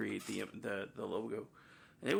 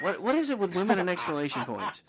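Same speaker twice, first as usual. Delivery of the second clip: raised, high-pitched mock complaint; mock exasperation; 8 semitones higher, 5 dB louder than usual. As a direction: gripe loudly in jest, pitched high. Acted exasperation at exclamation point complaint.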